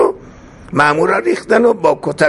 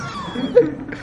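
high, quiet giggle; soft laugh; 12 semitones higher, 6 dB quieter than usual. Real, not acted. Storyteller amused by the comb beside the corpse, soft laugh. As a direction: giggle softly to yourself. Laughs while telling the tale.

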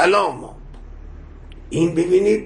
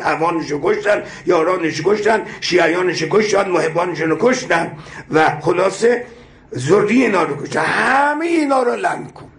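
fervent prayer; 4 semitones higher than usual. Acted. Preacher leading the congregation in the curse prayer, impassioned. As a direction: call down the curse like a preacher at full force.

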